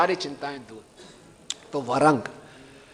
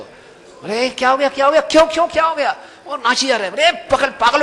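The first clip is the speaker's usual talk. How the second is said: alarmed cry; 9 semitones higher, 6 dB louder than usual. Retold or imitated. Friend alarmed at dancing man.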